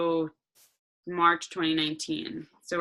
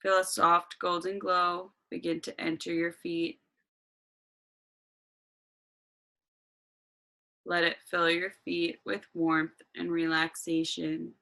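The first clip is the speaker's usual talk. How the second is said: guided meditation. Acted.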